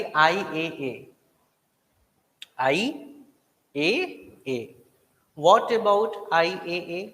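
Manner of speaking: drawn out very slowly with long pauses; slow drill. Shown as a demonstration. Teacher building suspense over IAA, very slow. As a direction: stretch every syllable for the class. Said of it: "अब देखो मजे की बात आ गई".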